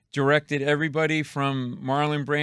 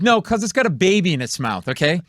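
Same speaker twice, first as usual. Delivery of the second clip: sarcastic retort; 4 semitones higher, 4 dB louder than usual. Retold or imitated. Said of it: "I'm like".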